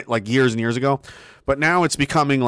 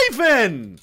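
mock alarmed scolding; 7 semitones higher than usual. Mock scolding of scary Ivan.

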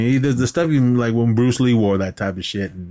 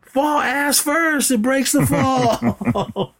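mock yell; much slower than usual. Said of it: "And Chris Rock is yelling".

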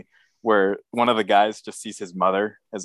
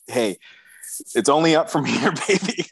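lightly voiced upbeat fan, rising high at the end; a breezy, mock-hopeful cheer from the bottom of the pit; mock cheery fan; 7 semitones higher than usual. Hosts picture media stuck in a hole, voiced as a mock cheery fan.

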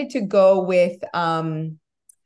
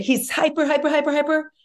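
higher, rattled-off "hyper"; higher, quick "hyper, hyper"; excited; 8 semitones higher than usual. Real, not acted. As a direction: bounce through the repeats. Describing son's hyperactivity.

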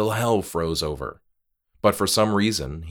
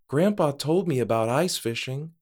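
put-on higher-pitched remembered child; young son's voice; 7 semitones higher than usual. A narrator's reading. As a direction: voice the boy, lifted in pitch. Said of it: "He thought of his son's words".

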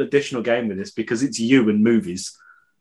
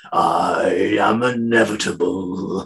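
slow, lower mock villain; villain line; 6 semitones lower, much slower than usual. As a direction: intone it like a doomed tyrant. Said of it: "just like".

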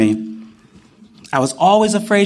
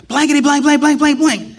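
mock cursing; 10 semitones higher than usual. Cursing at himself in frustration, mock outburst. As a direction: spit out the censored swearing. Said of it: "I'm like".

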